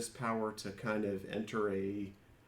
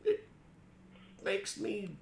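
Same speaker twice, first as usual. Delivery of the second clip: higher, slow in-character player voice; higher polite adventurer voice to the librarian; 5 semitones higher than usual. Acted.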